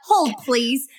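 a light imitation of a Southern accent; lightly voiced Southern accent, teasing. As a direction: playfully mimic a coworker's Southern accent on a Zoom call. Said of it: "in her Southern accent".

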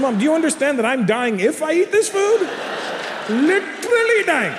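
put-on very high, exasperated kid's protest; imitated pleading son. Son protests to mother about food, high mock exasperation. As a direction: argue back at mom, drawing out the last words. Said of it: "I said".

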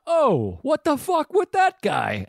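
high, slow New York mook; New York accent. Retold or imitated. Two guys by their truck at a coughing man, New York accent. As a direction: exclaim it like an indignant New Yorker. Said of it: "real New York".

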